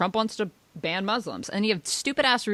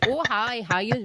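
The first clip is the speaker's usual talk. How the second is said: lightly voiced Minnesota nice greeting; a hint of chirpy Minnesota accent, higher; friendly Minnesota accent, lightly put on; 5 semitones higher than usual. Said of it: "is that your Scandinavian accent".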